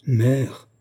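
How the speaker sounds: mild indignant exclamation, rising at the end; indignant cry. A narrator's reading. Daughter protesting at her mother's doubt, mild indignant cry. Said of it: "s'écria Olga, pâle d'indignation".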